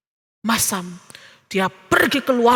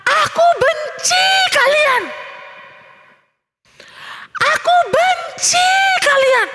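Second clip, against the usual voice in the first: very high, drawn-out angry shout; yelling at full force; 13 semitones higher, slower than usual. A narrator's reading. Theo yells into the valley at his family, furious shout. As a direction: scream it out slowly across a valley. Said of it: "Theo berteriak sekencang-kencangnya".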